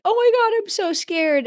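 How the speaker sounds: put-on high, loud frightened little girl's cry; scared inner child. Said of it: "And she was like".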